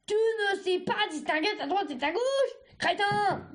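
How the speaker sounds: high, exasperated scolding; angry yell. A narrator's reading. Cercerelle yelling in Argile's ears, angry yell. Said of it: "hurla Cercerelle".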